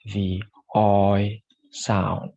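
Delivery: slow, drawn-out Oi sound; the "Oi" diphthong stretched for learners; Oi sound demo. Shown as a demonstration. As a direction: glide the "Oi" from o to i slowly. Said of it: "And this is".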